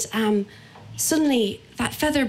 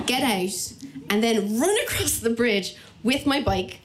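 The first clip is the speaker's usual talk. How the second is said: ordinary delivery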